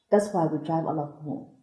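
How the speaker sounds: ordinary talk, nothing marked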